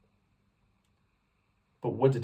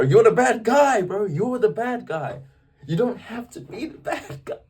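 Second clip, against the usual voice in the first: much higher and louder, stretched-out words; impassioned acted outburst; 12 semitones higher, 8 dB louder, slower than usual. Actor playing Cain in a church performance, impassioned outburst. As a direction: cry it out like an anguished stage part. Acting his role as Cain.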